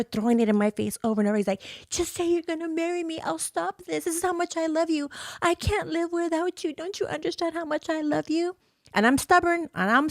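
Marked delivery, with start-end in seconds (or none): 1.9-8.6 high, strained mock begging; sustained high-pitched imitation of his begging; pleading abusive ex. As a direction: imitate a manipulative ex desperately pleading. Voicing ex begging her to marry him.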